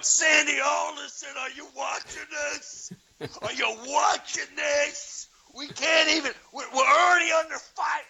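high, loud outraged yell; furious fan yell. Retold or imitated. Fan yelling at Sandy Alderson over the losing streak, high outraged shout. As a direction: call out the general manager in disbelief. Yelling at Mets general manager.

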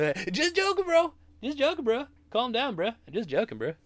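high, hammed-up bro voice; mocking dudebro. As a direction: squeal the excuse like a smug bro. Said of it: "mocking the joke excuse".

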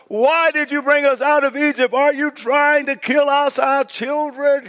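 high, heated accusation; angry crowd. Acted. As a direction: throw the blame at Moses.

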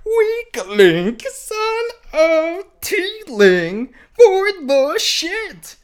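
high, slow cartoon princess voice; sing-song it like a cartoon princess. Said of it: "she said in a princess voice".

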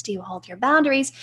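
ordinary talk, nothing marked

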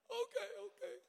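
high, small mock-tearful voice; imitated crying. Young preacher yielding to his screaming mentor, mock-tearful. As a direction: give in with a thin, high whimper. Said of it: "I'm crying".

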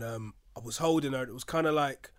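ordinary talk

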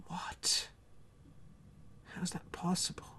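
hushed unvoiced aside; a stunned whisper to himself. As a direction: whisper it in mock disbelief. Mock astonished aside.

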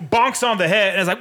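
ordinary talk, nothing marked

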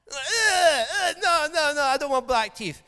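high, loud hammed-up child wail; mock crying child. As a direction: wail like a frightened kid.

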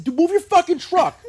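high, loud retold outburst; exasperated yell. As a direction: yell it as in an argument. Retelling his parking argument.

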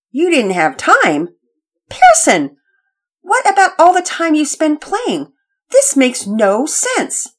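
loud, slow, high mother's scolding; exasperated scolding. Acted. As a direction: let the frustration build.

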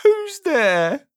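high, squeaky call, drawn sadly; piping, sorrowful squeak. A narrator's reading. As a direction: squeak the question out miserably. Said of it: "a sad, squeaky sort of voice".

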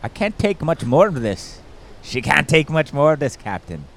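Scotty. Acted. Riffing on the Scotty in the story, mock engineer's plea. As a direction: plead like an overtaxed starship engineer. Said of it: "Oh, Scotty".

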